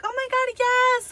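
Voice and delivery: high-pitched, thrilled squeal of approval; happy friend. Retold or imitated. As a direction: imitate a delighted best friend. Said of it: "so happy. She's like".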